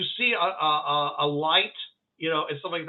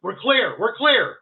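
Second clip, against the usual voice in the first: raised, louder call; higher, urgent-sounding announcement; rescuer calling out; 6 semitones higher, 6 dB louder than usual. Acted. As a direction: call the all-clear to the crew. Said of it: "and the guy says".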